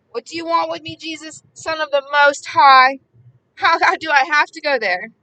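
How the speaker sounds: loud, slowed desperate cry to Jesus; shouting at the top of his voice. A narrator's reading. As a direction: cry it out as a possessed man would. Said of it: "he shouted at the top of his voice".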